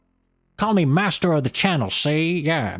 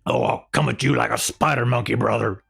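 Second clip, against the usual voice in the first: pro wrestler voice; 2 semitones lower than usual. Pretend. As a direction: threaten like a ring announcer hyping a match. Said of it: "talk like a professional wrestler".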